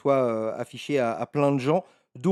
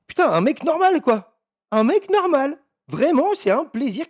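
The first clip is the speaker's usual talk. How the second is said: excited; 9 semitones higher, 4 dB louder than usual. Real, not acted. Enthused about normal guy.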